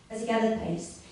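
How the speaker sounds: ordinary delivery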